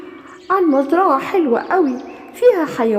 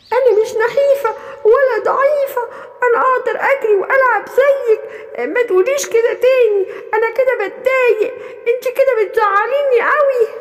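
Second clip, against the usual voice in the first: higher little goat voice, hurt and protesting; hurt thin goat; 7 semitones higher than usual. Acted. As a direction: defend yourself with a half-laugh, half-sob.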